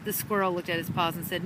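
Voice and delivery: ordinary delivery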